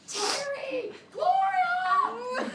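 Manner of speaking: high, loud, desperate shout; yelling for the neighbors. Retold or imitated. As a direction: call out across to the neighbors' house. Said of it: "literally yelling from the bathroom".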